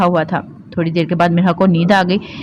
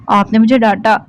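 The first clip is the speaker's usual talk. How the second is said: ordinary delivery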